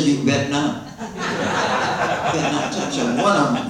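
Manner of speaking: half-laughing, playful warning to a man. Retold or imitated. To a man eyeing her women, laughing warning. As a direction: laugh through a mock threat.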